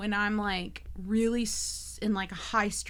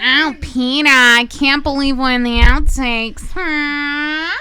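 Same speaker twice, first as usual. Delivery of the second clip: very loud, higher cartoon wail; goofy character voice; 3 semitones higher, 14 dB louder than usual. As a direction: overact it like a sitcom spouse.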